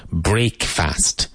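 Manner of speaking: "Break fast" as two separate words, "break" stressed; "Break fast" with both halves clear; split break fast. Shown as a demonstration. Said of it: "That comes from break, fast".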